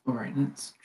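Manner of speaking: resigned, hushed sigh of a plan. Acted. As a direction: say it quietly, as if with a sigh. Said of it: "He kinda, like, sighs and says".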